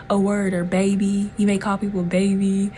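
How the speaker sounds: usual speaking voice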